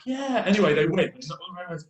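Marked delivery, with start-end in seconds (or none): 0.1-0.4 much higher, squeaky yell; mock kid cheer. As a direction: imitate a kid whooping at his team. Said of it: "And he's like".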